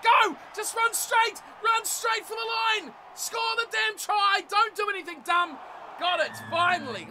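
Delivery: very high, loud desperate yelling; yelling from the sidelines. Real, not acted. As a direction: roar them on to the try line. Gamer yelling at his runner.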